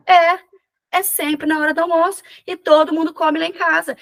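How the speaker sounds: patient suddenly sees it, eager realization. Retold or imitated. It dawns on her, said with a rush.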